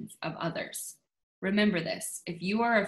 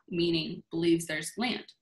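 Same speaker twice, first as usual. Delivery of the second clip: ordinary talk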